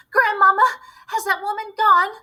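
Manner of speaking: high, loud, anxious call to grandmother; scared child calling down; frightened call. Acted. Frightened boy calling from tree.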